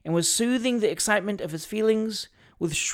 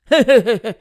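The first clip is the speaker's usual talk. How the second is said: loud, higher acted chuckle; hammed-up attorney's laugh; character's laugh; 6 semitones higher, 11 dB louder than usual. A narrator's reading. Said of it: "laughed Mister Pell".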